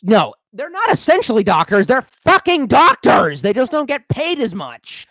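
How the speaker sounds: indignant rant, slowing down to stress each word; heated emphasis. Real, not acted. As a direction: hammer the point home with indignation. Heated rant defending vets.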